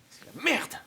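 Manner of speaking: high outburst on MERDE; exasperated curse. Acted. Trapped prisoner cursing the mess, exasperated outburst on MERDE. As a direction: curse it out, MERDE shooting up in pitch.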